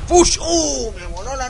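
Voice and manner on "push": startled yelp. Real, not acted. Blown away by magic.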